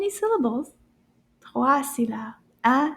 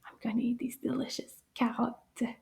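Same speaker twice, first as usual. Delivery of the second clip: much softer, whispered secret snack; playful whisper; 9 dB quieter than usual. Pretend. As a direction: whisper it like a sneaky treat. Teacher pretends to snack. (chuchotement espiègle sur le goûter)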